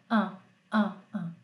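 an nasal repetido; som an. Shown as a demonstration. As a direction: repita an, an, an nasal. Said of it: "É o an que você fala". (professora demonstra o som an)

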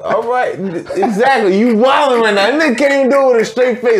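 high-pitched amused outrage; laughing disbelief. Real, not acted. Host catching friend unable to keep straight face, laughing outrage. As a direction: call him out, high and laughing.

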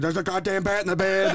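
high, frantic retold cry; high panicked shout to the wife. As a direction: panic about the bat in the bed. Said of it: "And I was like".

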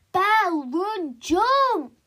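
high, loud mother's yell; a pushy mum yells at her daughter to jump; 1 yelling at the race. Acted. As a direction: scream her on from the sidelines.

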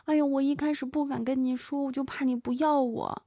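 high, soft imitated weeping plea; mock tearful confession. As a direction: imitate a young woman pleading through tears, lightly. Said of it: "他又哭着说". (学着小声哭诉)

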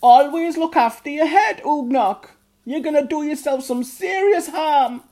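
a high, loud scolding mother's voice; strict goblin mother. A narrator's reading. Mother goblin telling off Ugnok, high loud scold. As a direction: scold him like a strict mum. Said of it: "his mum said".

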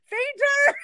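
high, loud yell mid-laugh; yelled warning. Retold or imitated. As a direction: shout it out in alarm. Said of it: "and I yelled".

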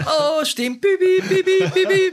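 very high, loud beeping like a kettle; steam whistle beeps. Retold or imitated. Imitating steam pouring from a furious man's ears, high loud beeps. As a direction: squeal like steam coming out of ears. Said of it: "Thinking of [name]".

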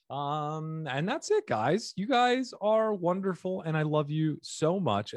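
high, gushing sign-off. Pretend. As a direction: swoon over the listeners.

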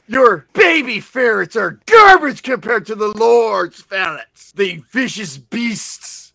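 indignant rant. Acted. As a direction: defend the ferrets hotly. Minion rants about ferrets.

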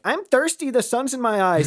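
high-pitched mock complaint; complaining newcomer. First-time friend moans to a festival regular, high mock complaint.

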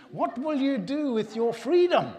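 full-voiced battlefield yell, very high and loud; William Wallace. Preacher playing Braveheart after disclaiming his Scottish accent, loud high rallying shout. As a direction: roar it like a film hero rallying troops. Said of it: "William Wallace Braveheart film, when he says".

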